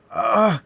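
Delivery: high, loud "ah" of agony; agonized cry. A narrator's reading. Dying man seeing D'Artagnan, loud agonized cry. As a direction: cry out at the sight of him. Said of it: "cried he, on perceiving D'Artagnan".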